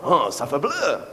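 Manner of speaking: hammed-up French accent tossing off nonsense; mock French accent, deadpan; fake French accent. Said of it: "if it's in a French accent".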